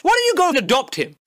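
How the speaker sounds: normal talking voice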